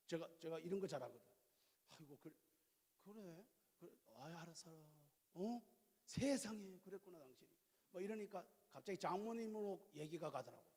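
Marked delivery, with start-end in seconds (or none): 1.9-7.5 much softer, gentle murmured replies; hushed tender husband; soft sympathetic listening. Retold or imitated. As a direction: soothe her as she talks. Husband voices patient listening.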